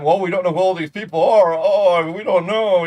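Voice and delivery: loud, high mock bewilderment; hammed-up feigned ignorance; mock cluelessness. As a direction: imitate someone pretending to have no idea.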